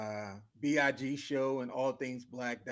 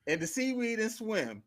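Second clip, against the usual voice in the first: daughter's advice; 3 semitones higher, 6 dB louder than usual. Retold or imitated. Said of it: "turns to me and said".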